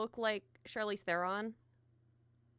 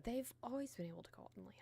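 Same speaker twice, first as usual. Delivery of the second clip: quick unvoiced whisper; 8 dB quieter, much faster than usual. Real, not acted. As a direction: whisper it fast as an aside. Aside whisper.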